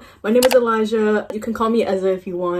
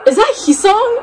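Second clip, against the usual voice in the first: high, louder surprised cry; 13 semitones higher, 6 dB louder than usual. Real, not acted. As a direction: gasp it out on spotting him. Spotting a member on screen.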